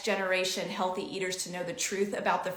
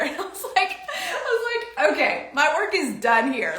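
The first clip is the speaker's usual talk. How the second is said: high, louder laugh through the words; 8 semitones higher, 6 dB louder than usual. Real, not acted. Mother laughing while telling how her daughter loved the pizza, delighted. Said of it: "laughs while telling".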